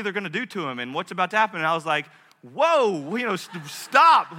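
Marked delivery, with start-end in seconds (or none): 2.4-4.4 loud, high "whoa, stop"; shocked protest. Retold or imitated. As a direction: cut in, alarmed, to halt the nurse. Father's shocked reaction to nurse.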